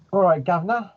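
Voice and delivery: put-on cheeky British accent, high on Governor. Player greets the group in a British accent. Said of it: "You're gonna get so much feedback for that accent".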